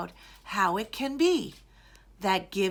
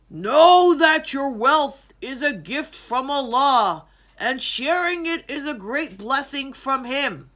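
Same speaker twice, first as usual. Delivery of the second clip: louder solemn royal voice; lightly voiced king; 2 semitones higher, 7 dB louder than usual. A narrator's reading. King tells the crowd wealth is a gift, solemn announcement. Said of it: "The king said one more thing".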